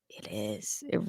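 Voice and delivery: a breathy whispered echo; whispered aside. Real, not acted. Podcaster whispers her own agreement, whispered aside. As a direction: drop to a whisper to herself.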